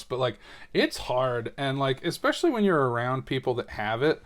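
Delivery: ordinary delivery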